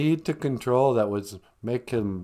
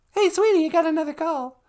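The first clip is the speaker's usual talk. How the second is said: high falsetto interruption; sweetly put-on high voice; high-pitched assistant character; 4 dB louder than usual. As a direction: call over to the host in falsetto. Assistant figure interrupts the host.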